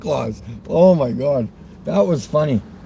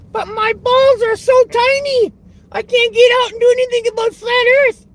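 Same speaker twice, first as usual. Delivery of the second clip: much higher mock voice of a puffed-up soldier; mocking military man. Mocking a Canadian soldier as a high-voiced weakling. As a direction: squeak it out to belittle him.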